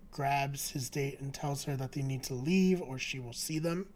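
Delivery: ordinary talk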